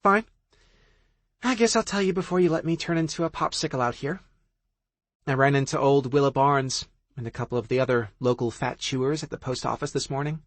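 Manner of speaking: high, quick gossiping friend; gossipy friend. Acted. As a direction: spill the gossip eagerly.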